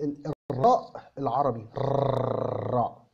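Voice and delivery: الراء said slowly with a trilled Arabic R; the Egyptian R the learners use; Arabic rolled R. Shown as a demonstration. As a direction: draw out الراء with a tapped tongue-tip R. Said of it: "إن إحنا بنقولها زي".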